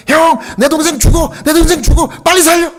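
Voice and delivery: put-on a boy begging his older cousin to save his brother; frantic child scream. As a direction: wail for help, frantic.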